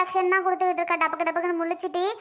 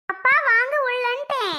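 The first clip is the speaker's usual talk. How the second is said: higher, insistent repeated call; insistent urging; 5 semitones higher than usual. Acted. Daughter repeating her call to hesitant father, insistent. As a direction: press him to come in.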